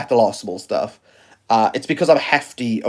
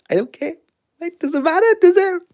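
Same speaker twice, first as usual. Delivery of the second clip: mock dismissive. Mock reply in high voice.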